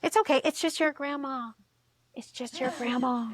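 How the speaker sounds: high, cooing mock reassurance; soothing brain. Brain covering up a frightening sight, mock soothing. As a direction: coax gently, as the brain telling a comforting lie.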